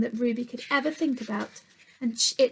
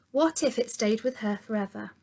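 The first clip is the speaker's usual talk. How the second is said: plain reading voice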